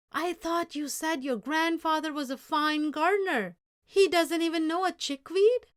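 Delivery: loud, high indignant complaint; angry. Acted. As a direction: scold with open anger. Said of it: "mustache quivered with anger".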